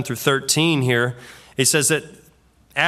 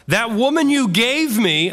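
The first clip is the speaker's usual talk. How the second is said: higher, slow, accusing protest; a defiant man shifting the blame; 7 semitones higher than usual. Shown as a demonstration. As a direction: fling the blame at her, slow and pointed. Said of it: "I don't think he said it like that".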